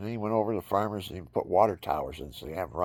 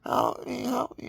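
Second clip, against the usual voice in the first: put-on fading child's cry for help. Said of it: "The kid's going".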